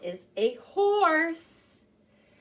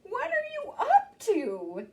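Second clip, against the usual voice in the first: Mama Pig voice; 11 semitones higher, slower than usual. Acted.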